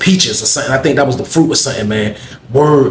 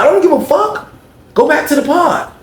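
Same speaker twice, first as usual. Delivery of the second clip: much higher, sharp dismissal; imitating a rude lunch lady; lunch lady brushing off; 14 semitones higher than usual. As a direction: shoo him off, pitched way up.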